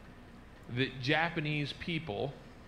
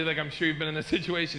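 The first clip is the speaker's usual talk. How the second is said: normal talking voice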